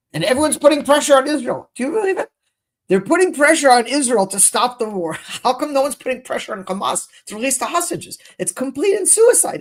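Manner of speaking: indignant outburst. Real, not acted. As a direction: rail against the unfairness. Outraged rant about hostages.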